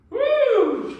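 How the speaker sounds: high, loud whoop; woo yell. Real, not acted. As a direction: let out a big whoop. Yell during reps.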